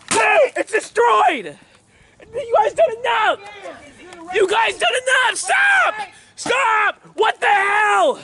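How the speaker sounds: high, drawn-out yells of protest; real outraged yelling; distraught shouting. Real, not acted. Vlogger pleads for his only TV, distraught shouting. Begging them to stop wrecking TV.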